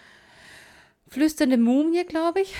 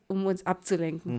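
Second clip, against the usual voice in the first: normal talking voice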